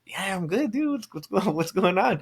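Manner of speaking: high-pitched, retold bewilderment; mock defensive. Groom answering a friend checking on him, mock defensive.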